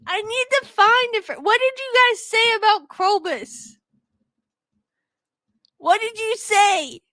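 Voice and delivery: high, loud, insistent demands; frantic. Real, not acted. Hunting the episode that slandered Krobus, frantic. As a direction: press him hard for the answer.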